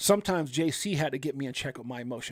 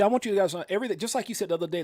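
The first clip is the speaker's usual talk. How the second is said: usual speaking voice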